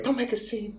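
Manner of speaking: soft, high-pitched grandma hush; tiny high voice, pleading quietly; imitated grandma. Grandma hushes grandpa at the restaurant, soft high imitation. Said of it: "my grandma would be like".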